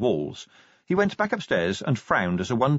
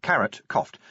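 plain reading voice

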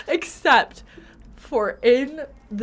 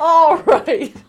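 laughing; 6 semitones higher, 9 dB louder than usual. Real, not acted. Laughing exclamation.

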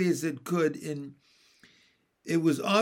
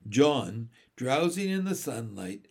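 usual speaking voice

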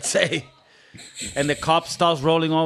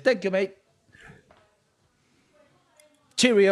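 high-pitched mock English farewell; English accent; 5 semitones higher than usual. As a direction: impersonate an English gatekeeper. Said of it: "a guy goes like this".

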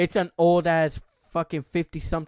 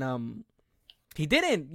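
normal talking voice

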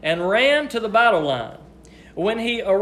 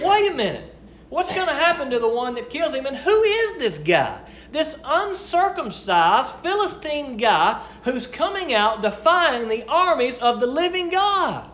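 put-on higher, incredulous young David demanding answers; indignant David; 6 semitones higher than usual. Preacher voicing young David at the giant, incredulous. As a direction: voice David's disbelief at the giant's challenge.

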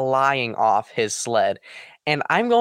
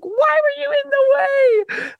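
put-on high, loud, tearful wail of a little girl; crying shout; 4 dB louder, slower than usual. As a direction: wail it out like a hurt child crying. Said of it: "she was, like, crying".

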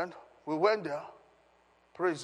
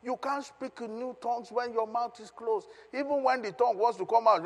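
normal talking voice

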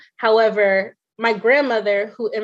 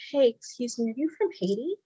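soft, slightly raised, tentative voice; tentative imagined question; 2 semitones higher, 8 dB quieter than usual. Retold or imitated. Patient wanting to ask the eye examiner, softly tentative. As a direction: ask it softly, as if approaching a stranger. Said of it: "I wanted to be like".

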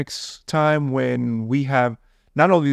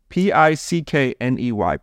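normal talking voice

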